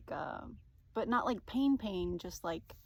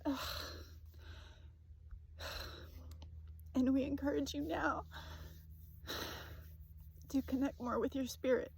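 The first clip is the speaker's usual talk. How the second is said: channeled message reading. Acted. Reading a spirit guide's message to viewers, soothing. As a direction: give it calmly, like a gentle oracle.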